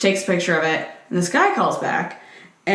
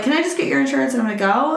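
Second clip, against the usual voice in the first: impatient rush; 6 semitones higher, much faster than usual. Retold or imitated. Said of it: "So I was like".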